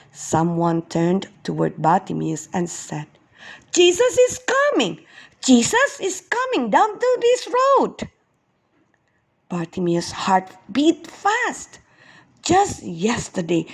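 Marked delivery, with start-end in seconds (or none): 3.7-8.1 excited announcement. Acted. Passerby announces Jesus to Bartimaeus.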